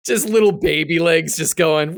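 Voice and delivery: ordinary delivery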